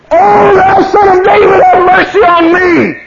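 very high, loud cry, slowing; desperate shout. Retold or imitated. Bartimaeus crying out over the crowd, desperate shout. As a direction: shout for mercy with all you have. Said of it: "he cried the louder".

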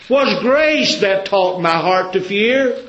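slow, high, loud chanted line; preached hymn line. Acted. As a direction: stretch it out like a hymn cried from the pulpit. First line of hymn quoted.

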